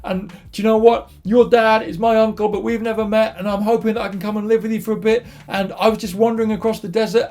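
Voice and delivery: high, emotional acted gush; gushing suitor. Jacob explains himself to Rachel at the well, gushing.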